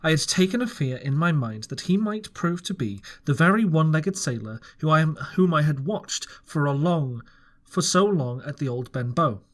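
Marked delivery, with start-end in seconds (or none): none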